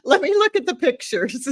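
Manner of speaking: teasing impression, high and loud; mock someone eagerly picking by looks; hammed-up, high-pitched eager request; Paul. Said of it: "that's Paul's interview and criteria".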